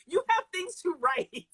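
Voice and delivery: high, slow mock scolding; exasperated editor. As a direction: imitate an editor nagging the author, drawn out. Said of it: "my editor's like".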